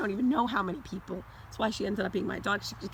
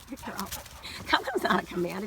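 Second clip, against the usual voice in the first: ordinary delivery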